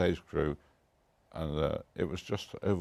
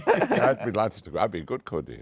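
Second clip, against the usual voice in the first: said through a laugh, higher and louder; 4 semitones higher, 7 dB louder than usual. Real, not acted. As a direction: laugh while you accept the joke role. Joking offer to play Cordelia.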